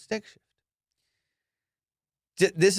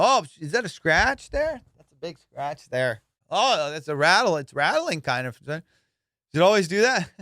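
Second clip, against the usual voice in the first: fake fussy buyer; 4 semitones higher, 4 dB louder than usual. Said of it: "doing all this production for him".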